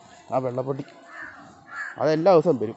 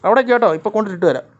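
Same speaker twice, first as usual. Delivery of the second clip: high, loud shouting to the cats; calling out; 10 semitones higher, 10 dB louder than usual. Real, not acted. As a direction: shout across to the cats that food is coming. (ദൂരെയുള്ള പൂച്ചകളോട് ഉറക്കെ വിളിച്ചുപറയുന്നു)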